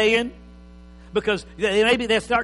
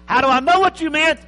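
higher, loud exasperated wife; mock wife; 8 semitones higher, 10 dB louder than usual. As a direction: ask it like a fed-up spouse. Acted wife in quarrel.

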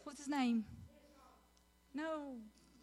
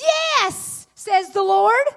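Yes leaps up high and loud, then a slow ringing line; louder, slow and triumphant; excited God; 3 semitones higher, 8 dB louder, slower than usual. Acted. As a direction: proclaim it as God exulting. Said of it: "like God himself got excited".

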